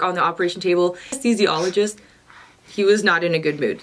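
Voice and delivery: ordinary delivery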